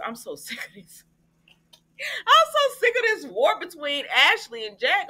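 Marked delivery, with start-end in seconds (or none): 1.5-5.1 high, loud fake-crying whine; mock wail. Fan hamming up her despair over the endless feud, mock crying. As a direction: wail it like you're at your wit's end.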